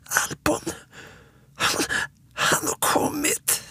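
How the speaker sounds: put-on high, partly whispered frightened child; small scared squeak. A narrator's reading. Småtting thinks the monster has come, small scared peep. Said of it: "piper med liten rädd röst".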